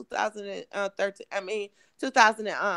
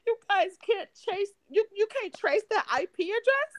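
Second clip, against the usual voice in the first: demanding detectives; 7 semitones higher than usual. Retold or imitated. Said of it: "I'm talking to the detectives".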